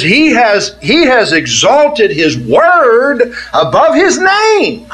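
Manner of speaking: ringing scripture proclamation, slow on key words; emphatic preaching. Real, not acted. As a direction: stretch key words and soar at phrase ends.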